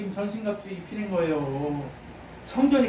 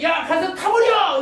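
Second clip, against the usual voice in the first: loud, high, slow shouted order; booming divine command; 10 semitones higher, 8 dB louder, slower than usual. Acted. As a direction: thunder it like God sending you out. God's command acted in sermon.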